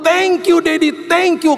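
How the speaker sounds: very high, drawn-out grateful shout. Retold or imitated. Daughter hugging dad to thank him, high excited shouting. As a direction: squeal thanks like an overjoyed child. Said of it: "Dia makin berteriak".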